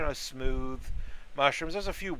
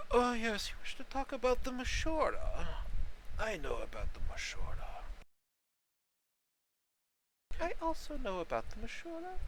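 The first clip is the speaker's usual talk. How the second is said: put-on old mushroom voice; 6 semitones higher, 4 dB quieter than usual. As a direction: elderly voice. GM voices elderly mushroom NPC.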